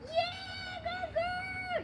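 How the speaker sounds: high shouted cheer. Real, not acted. Owner cheering the husky toward the ball, high shout.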